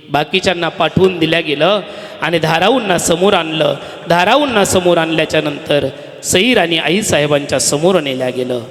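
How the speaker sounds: ordinary talk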